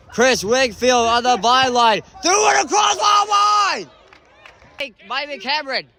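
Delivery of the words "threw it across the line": high loud rush of the play call; excited shout. Real, not acted. Commentator calling a big play, high excited shout.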